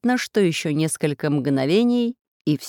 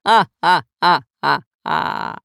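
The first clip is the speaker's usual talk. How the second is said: slow, loud booming cackle; villain laughing; 5 dB louder, much slower than usual. Acted. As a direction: laugh it out in triumph. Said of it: "весело расхохотался".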